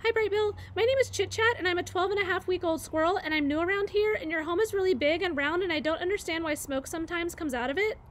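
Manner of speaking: high, rapid babbling voice; chattering squirrel. Acted. Young squirrel Chit Chat rambles at the gosling, rapid high chatter. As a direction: rattle it off without a breath like an excited young squirrel.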